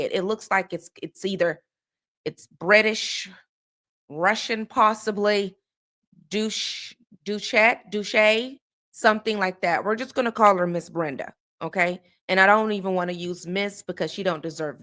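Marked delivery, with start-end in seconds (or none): 6.3-8.6 sounding out "Dush-", "Duchet", "Duche" one by one, hesitant; trying out a name. Shown as a demonstration. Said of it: "I don't know how you pronounce this woman's last name".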